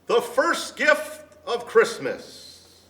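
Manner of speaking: high, slow proclamation; called out. A narrator's reading. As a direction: announce it grandly to the crowd. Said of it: "called out".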